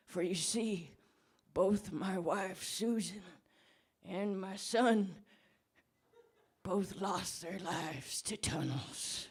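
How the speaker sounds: a put-on mournful confession; mock grief. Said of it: "I'm getting very emotional".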